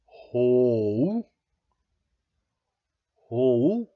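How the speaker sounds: "Ho" as a long, loud, drawn-out "hoh"; shown pronunciation. Shown as a demonstration. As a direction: stretch "Ho" with a long O, rising at the end. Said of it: "Anders is saying that Hu is pronounced".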